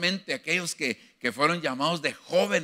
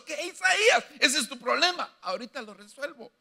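God brushing the problem aside; 11 semitones higher than usual. Retold or imitated. God answers Isaiah.

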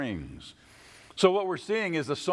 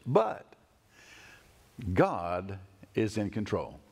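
much lower and slow, tossed-off ending; tacked-on afterthought; 6 semitones lower, much slower than usual. Shown as a demonstration. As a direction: drop it in as a little afterthought. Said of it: "like it's a little afterthought".

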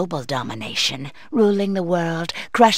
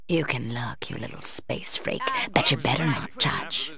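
whispered threat, "touch" hissed softly; menacing whisper; 5 semitones lower, 6 dB quieter than usual. Acted. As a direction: breathe the warning low at the alien. Threatening a watching alien.

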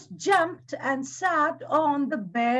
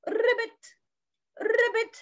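higher-pitched ribbit; frog croak; 7 semitones higher than usual. Acted. Storyteller introduces the frog to children, imitated croak.

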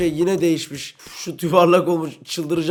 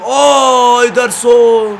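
loud, high cry of disbelief; shocked shout; 7 semitones higher, 11 dB louder, much slower than usual. Real, not acted. Gamer shouting at his keeper's blunder, shocked.

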